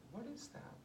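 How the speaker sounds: hushed, gentle probing question; soft, near-whispered therapist voice. Shown as a demonstration. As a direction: ask it softly, almost breathed, to slow the client down. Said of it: "press pause there and slow that down".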